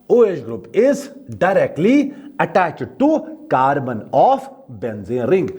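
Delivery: each word spaced out slowly for notes; slow dictation. Shown as a demonstration. Teacher to students taking notes, slow dictation. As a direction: read it out slowly so students can write. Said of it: "teacher dictating a definition".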